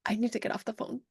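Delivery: soft, choked-back voice; barely keeping it together; holding back tears. Retold or imitated. Author ending a call with her printer, holding back tears softly. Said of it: "trying to hold back these tears".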